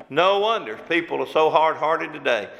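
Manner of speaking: ordinary talk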